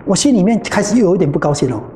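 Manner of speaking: ordinary delivery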